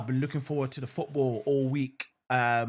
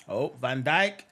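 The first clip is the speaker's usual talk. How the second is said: ordinary talk, nothing marked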